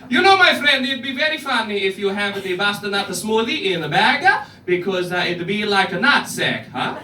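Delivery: terrible mock Italian accent, loud at the start; hammed-up Italian accent. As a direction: do the worst Italian accent imaginable. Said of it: "the worst Italian accent of all time".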